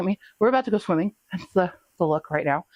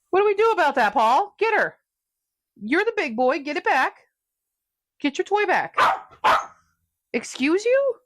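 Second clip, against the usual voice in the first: much higher, egging the dog on; pet talk; 9 semitones higher than usual. Real, not acted. Urging Paul to get his toy back, high pet voice.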